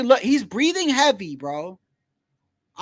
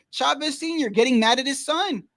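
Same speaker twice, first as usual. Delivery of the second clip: normal talking voice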